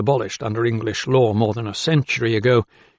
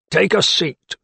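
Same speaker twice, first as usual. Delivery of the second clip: higher-pitched, curt command; ordering; 6 semitones higher, slower than usual. A narrator's reading. Keeper to doctor in his office, ordering. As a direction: make it an order, not an invitation. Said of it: "an order rather than an invitation".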